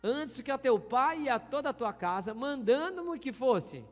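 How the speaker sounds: slow, loud, weighty verse reading; drawn-out, solemn pulpit reading; solemn scripture reading. Acted. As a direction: read each word like a proclamation. Pastor reads Bible verse slowly.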